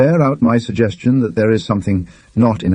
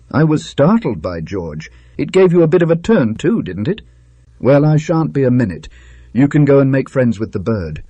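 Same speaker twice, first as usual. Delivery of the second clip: a hint of a higher, breezy gentleman; cheerfully brushing the matter aside, a touch higher; lightly voiced Manners; 6 semitones higher than usual.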